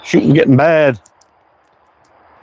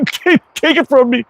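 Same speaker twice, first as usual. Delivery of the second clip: mock handing over; 10 semitones higher than usual. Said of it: "Here you go".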